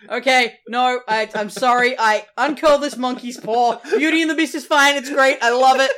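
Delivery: loud, high mock-panicked backtracking; over-the-top, pleading fake praise; frantic retraction. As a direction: take it all back with hammed-up desperation.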